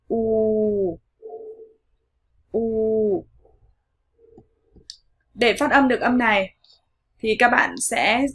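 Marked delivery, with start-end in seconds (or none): 0.1-3.3 "U" held long and steady; long U vowel. Shown as a demonstration. Teacher introducing the long English vowel, "U" stretched out. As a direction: draw "U" out as one long vowel. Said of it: "nguyên âm dài".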